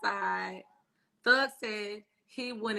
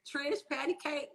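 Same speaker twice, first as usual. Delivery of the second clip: normal talking voice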